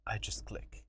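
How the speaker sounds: whispered, hushed click. Retold or imitated. Storyteller whispering the sneaky click. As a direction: breathe it out like a secret. Quiet moment before the trick.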